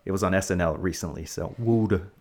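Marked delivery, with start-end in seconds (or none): 1.6-2.1 "water" said as "wooder"; Philadelphia pronunciation. Shown as a demonstration. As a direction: say "water" the Philadelphia way, "WOO-der". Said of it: "They water in Philadelphia".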